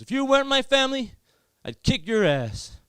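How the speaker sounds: high, loud American threat; high-pitched mock-angry shout; annoyed American cousin. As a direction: imitate a furious American teenager. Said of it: "He get really annoyed".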